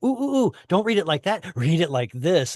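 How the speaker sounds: eager, high-pitched interjection settling on "this"; excited rabbis. Acted. Teacher acting out rabbis offering a new reading, excited interjection. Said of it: "where the rabbis are like".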